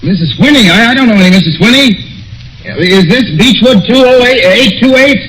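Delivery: high, disbelieving questions; confused son grows alarmed; rising panic. Acted. Son questions stranger on his mother's line, high and alarmed.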